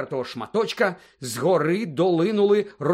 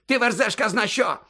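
high, agitated scolding; exasperated rebuke; 8 semitones higher, 4 dB louder than usual. A narrator's reading. Fudge rebuking Snape, exasperated and high. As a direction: snap at him for raving.

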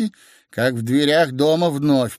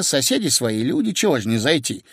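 ordinary delivery, nothing marked

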